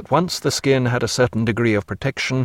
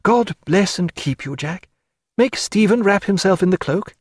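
higher, heartfelt farewell; tender young woman; 8 semitones higher than usual. Acted. Sophia bids Jack farewell, high and heartfelt. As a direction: promise it forever, higher.

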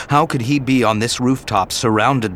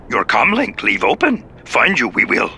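put-on higher, odd old master voice; Yoda voice; 4 semitones higher than usual. Yoda instructs Anakin over comlink, high and odd. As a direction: speak as little wise Yoda. Yoda's inverted syntax.

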